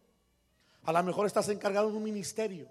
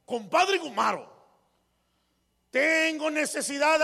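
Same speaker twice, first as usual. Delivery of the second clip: loud, higher and slow demanding read; shouted letter; 7 semitones higher, 6 dB louder than usual. Acted. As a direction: read it like he's yelling at you. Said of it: "así hablaba ya el señor".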